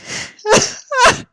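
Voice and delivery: high rising giggle; high laugh. Real, not acted. Reader laughing at the dumb fic, high giggle.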